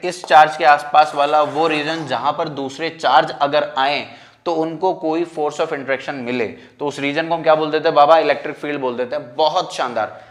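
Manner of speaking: usual speaking voice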